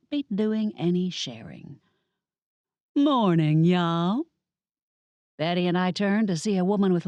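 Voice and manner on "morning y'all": put-on Southern accent, loud friendly hello; southern drawl greeting. A narrator's reading. Lori Lou greets Pepper and Betty in a Southern accent. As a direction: call it out in Southern country style. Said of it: "a thick country southern accent".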